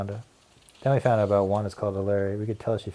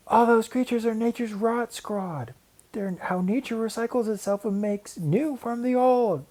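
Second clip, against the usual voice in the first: high, odd creature voice; gremlin voice; 12 semitones higher, slower than usual. Acted. Wanda as a gremlin questions Larry, eerie. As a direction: play a spooky log gremlin.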